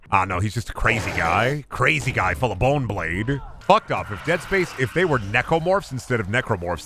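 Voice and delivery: usual speaking voice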